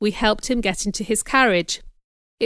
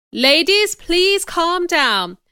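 high, raised voice to a group; calling for calm; 8 semitones higher, 5 dB louder, much slower than usual. A narrator's reading. Rochester hushes alarmed lady guests, calling for calm. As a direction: appeal loudly to the crowd.

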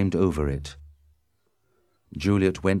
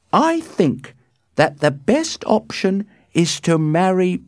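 high, loud, deliberate advice; emphatic, measured nurse, pitched way up; slow; 14 semitones higher, 6 dB louder than usual. A narrator's reading. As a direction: spell out the nurse's counsel slowly. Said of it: "she said slowly".

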